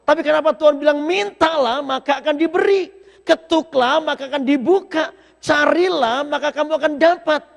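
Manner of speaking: loud, high preaching cry; preaching at full force. Acted. Preacher calls the verse to the congregation, loud and high. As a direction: lift the voice and shout the verse out.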